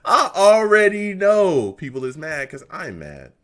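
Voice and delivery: slow, loud and high, half laughing; fed-up fan ranting loud. Real, not acted. Repeats louder, laughing.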